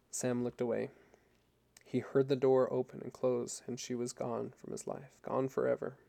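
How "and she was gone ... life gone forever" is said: usual speaking voice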